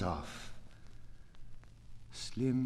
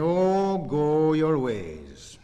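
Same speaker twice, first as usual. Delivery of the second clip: loud, higher, slow declaiming call; ringing cry; 7 semitones higher, 9 dB louder, slower than usual. Acted. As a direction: fling the words out like a dismissal. Poet dismissing with ringing cry.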